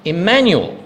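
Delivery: Emmanuel set apart after a pause; weighty single word. Real, not acted. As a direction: Emmanuel as the reveal. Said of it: "the answer is one word".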